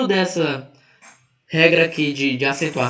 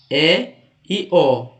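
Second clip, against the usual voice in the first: ordinary delivery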